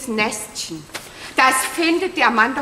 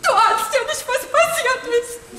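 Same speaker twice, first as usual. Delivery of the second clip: upset; 7 semitones higher than usual. Acted. Distraught woman bursting in.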